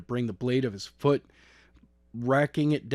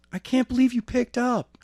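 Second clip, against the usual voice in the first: put-on high, amazed girl's voice; a girl who can't believe it, much higher; 11 semitones higher than usual. A narrator's reading. Girl on the phone, amazed he answered, high.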